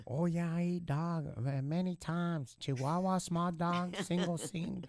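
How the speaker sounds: high-pitched caricature of a foreign inmate; put-on high voice, listing like a menu; mimicking inmate. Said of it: "I'm gonna mimic".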